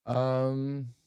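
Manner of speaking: testing through a glitchy mic; alien voice. Real, not acted. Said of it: "Sounds like I'm an alien".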